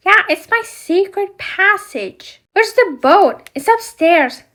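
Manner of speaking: high, lively toy voices; doll play voices. Acted. Ariel and Flounder eager for the adventure, high voices. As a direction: play the friends eager to set off.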